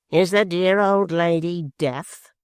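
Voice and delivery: slow, sneering mock politeness; smile with a frown. A narrator's reading. Quilp to his mother-in-law, slow mock-polite sneer. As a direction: needle her with a smiling frown. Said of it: "with a smile of which a frown".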